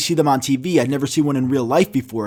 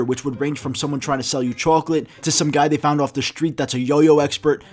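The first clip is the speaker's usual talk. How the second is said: usual speaking voice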